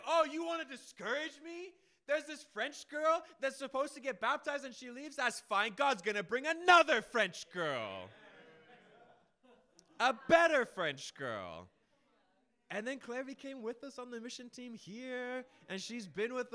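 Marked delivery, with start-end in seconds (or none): 0.0-11.7 loud, high taunt, slowing at the end; defiant shout. Acted. Preacher talking back to Satan after a setback, loud defiance. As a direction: throw it back in the enemy's face.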